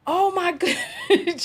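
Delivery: breaks into high laughter mid-phrase; laughing. Real, not acted. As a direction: laugh through the words in disbelief. Laughing at clip reaction.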